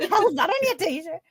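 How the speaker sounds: high, loud mock telling-off; mock outraged mum. Mum spotting a signed T-shirt at home, mock outrage. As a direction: imitate a mother squawking in disbelief. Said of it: "my mum would have killed us".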